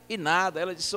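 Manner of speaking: ordinary talk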